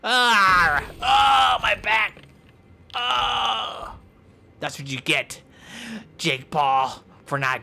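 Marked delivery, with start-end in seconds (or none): 0.0-4.0 high loud yelps of pain; pained cries. Pretend. Hurt wrestler crying out about his back in high yelps. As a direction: yelp like your back just broke.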